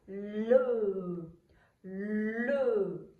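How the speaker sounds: "le" stretched very long, sound into sound; blending L and E. Shown as a demonstration. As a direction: slowly slide from L into E on "le". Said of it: "C'est le mot le".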